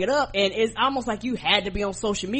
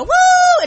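loud high "woo!"; long, high-pitched celebratory holler; cheering whoop; 13 dB louder than usual. Acted. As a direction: whoop it like an applauding crowd. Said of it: "so I can applaud and go".

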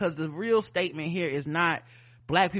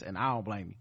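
ordinary talk

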